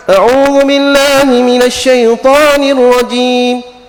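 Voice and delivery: slow, loud, drawn-out chanted recitation; Quran recitation. Retold or imitated. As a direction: intone it like a reciter in Mecca. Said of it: "baca Al-Quran".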